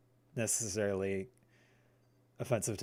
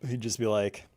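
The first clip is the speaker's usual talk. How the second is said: ordinary delivery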